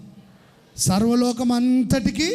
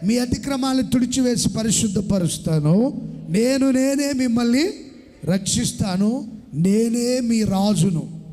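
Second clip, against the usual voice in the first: slow, booming voice of God; preacher proclaiming God's promise to the church; preaching scripture; 4 semitones higher, 3 dB louder, slower than usual. Acted. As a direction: declaim each word slowly. Reads God's words in sermon.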